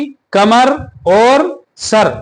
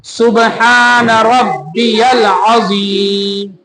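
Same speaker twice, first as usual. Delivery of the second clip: very slow, drawn-out सुबहान रब्बियल अजीम; slow and careful; much slower than usual. Shown as a demonstration. Teacher modelling the correct slow way for students. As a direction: stretch each word calmly. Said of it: "तो हमें कैसे पढ़ना है?".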